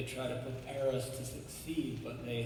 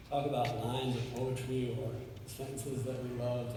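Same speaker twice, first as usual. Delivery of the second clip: normal talking voice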